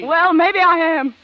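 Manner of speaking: slow bitter defiance; defiant drawl. Acted. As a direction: lean into each word. Defiant retort.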